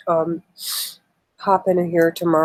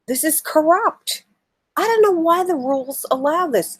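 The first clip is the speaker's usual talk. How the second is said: heated outrage, the voice rising; overly emotional; 5 semitones higher than usual. Real, not acted. Vlogger venting to viewers about unfair debate rules, worked up and rising. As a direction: pitch climbing higher, slowing down, worked up. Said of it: "I'm getting overly emotional".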